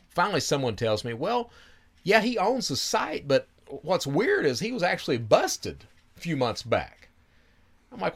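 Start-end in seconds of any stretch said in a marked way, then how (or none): 1.1-7.1 high put-on voice for the informant; high-pitched retold informant; informant's voice. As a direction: voice the tipster up high.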